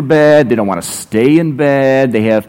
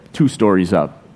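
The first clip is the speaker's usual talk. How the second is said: normal talking voice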